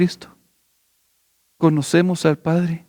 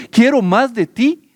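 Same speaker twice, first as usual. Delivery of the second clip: higher, more urgent cry; rising plea; 8 semitones higher, 3 dB louder than usual. Acted. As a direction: lift the plea up with longing. Same plea, raised higher.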